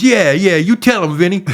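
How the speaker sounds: high, loud hyped-up egging on; Tracy Morgan hype. Retold or imitated. Headliner handing the mic to Vinny, high and hyped. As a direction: cheer him on like a rowdy headliner.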